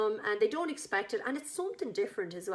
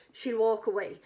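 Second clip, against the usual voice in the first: normal talking voice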